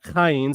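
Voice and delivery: "Chayin" opened with a guttural, throaty K; guttural K sound. Shown as a demonstration. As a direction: start "Chayin" with a raspy back-of-throat kh. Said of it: "that guttural sort of K sound".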